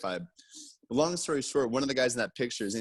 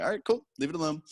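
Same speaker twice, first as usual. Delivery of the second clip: pitched up, hammed-up nervous retreat; mock backing off; 6 semitones higher, faster than usual. Storyteller backs off from the aggressive vendor, high nervous mock voice. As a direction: squeak it out, backing away fast.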